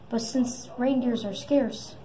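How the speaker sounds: usual speaking voice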